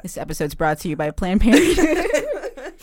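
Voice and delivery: slow, laughing mock ad announcement; mock sponsor read. Jokingly announcing a sponsor to the podcast hosts, mock ad read.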